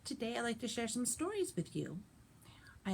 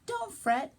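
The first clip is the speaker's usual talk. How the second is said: a light high mouse squeak; squeaky mouse; 12 semitones higher, 9 dB louder than usual. Acted. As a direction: pipe it up like a tiny mouse. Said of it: "Mouse squeaks".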